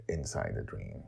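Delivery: a low hushed echo of inside the dream; hushed ominous echo. A narrator's reading. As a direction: let inside the dream sink down to a hush. Repeated phrase drops low and soft.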